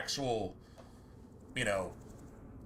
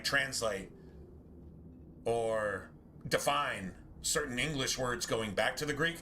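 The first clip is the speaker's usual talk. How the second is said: ordinary delivery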